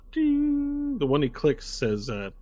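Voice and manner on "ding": high, held bell tone; imitated elevator ding. Game master voices the elevator doors closing, high chime. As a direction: sing out a long ringing "ding". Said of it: "The door's closed".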